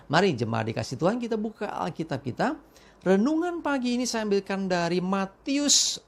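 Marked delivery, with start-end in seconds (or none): none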